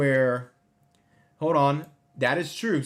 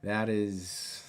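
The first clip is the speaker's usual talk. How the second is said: normal talking voice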